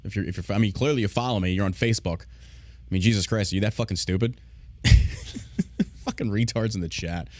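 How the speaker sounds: ordinary talk, nothing marked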